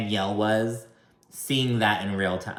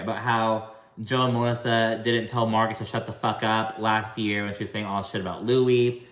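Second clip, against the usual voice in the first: ordinary delivery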